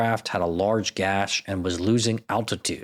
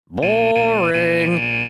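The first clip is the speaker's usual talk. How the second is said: mock bored; 7 semitones higher, 5 dB louder than usual. Real, not acted. Mocking the alien broadcast.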